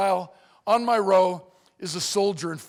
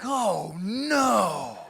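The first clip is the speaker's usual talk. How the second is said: drawn-out, understated dread at usual volume; dismayed. Acted. Two seated guys seeing a big man arrive, drawn-out dismay. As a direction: groan the words slowly in mock dismay. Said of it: "looking up at me like".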